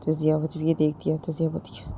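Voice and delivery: plain reading voice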